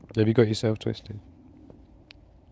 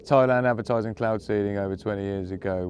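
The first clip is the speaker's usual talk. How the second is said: usual speaking voice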